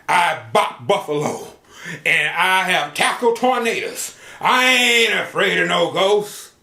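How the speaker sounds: loud boastful cowboy; bragging cowboy. Acted. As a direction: brag like the toughest cowboy alive. Cowboy brags about toughness.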